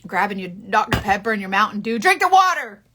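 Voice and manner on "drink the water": stern command. Pretend. Scolding viewers to drink water.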